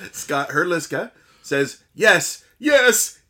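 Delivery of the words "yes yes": high, loud, building yeses; excited yes. Retold or imitated. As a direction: get more thrilled with each yes. Reading a listener's excited comment.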